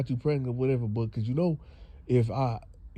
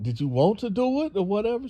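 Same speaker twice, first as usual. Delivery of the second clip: high pressing question; 11 semitones higher, 4 dB louder, faster than usual. Retold or imitated. Mother presses daughter.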